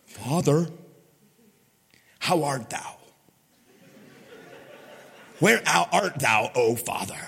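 hammed-up pious address, drawn out and hushed at the ends; mock-solemn prayer. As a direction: parody a pompous, reverent pray-er, with long pauses. Mock-formal prayer contrasted with casual.

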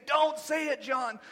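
like a voice holding him back; urgent warning. Retold or imitated. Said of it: "the Holy Spirit's pulling the reins back, saying".

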